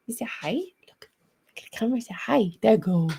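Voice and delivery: high, sing-song praise for the dog; puppy talk. Real, not acted. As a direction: coo at a big puppy. Coaxing dog to camera.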